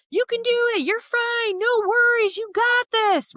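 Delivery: hammed-up rah-rah cheer; cheerleading. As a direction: pump them up like a cheerleader. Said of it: "That is equivalent to us cheerleading".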